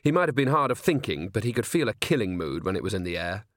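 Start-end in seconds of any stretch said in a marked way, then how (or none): none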